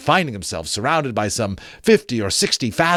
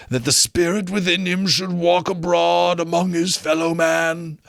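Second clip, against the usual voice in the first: higher, slow wailing moan that swells loud; ghost lamenting; 8 semitones higher, 3 dB louder than usual. Acted. Marley's ghost explaining his doom, slow wailing ghost voice. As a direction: mourn it like a doomed spirit.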